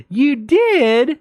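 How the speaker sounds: high, gushing question; light up with high-pitched delight; delighted surprise. Retold or imitated. Neighbors hear she hung out with their cat, high delighted surprise.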